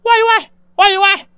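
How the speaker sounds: high, loud yapping woof; mocking dog bark. A narrator's reading. Mother barking to mock the husband's threats, taunting. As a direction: yap at him like a small dog.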